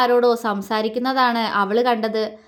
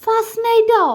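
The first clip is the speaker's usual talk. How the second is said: high, loud, wide-eyed disbelief; startled question; 10 semitones higher, 5 dB louder than usual. A narrator's reading. Bride staring at her husband's bold hint, startled. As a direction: echo it back in shocked surprise. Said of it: "Appu അവനെ മിഴിച്ചു നോക്കി".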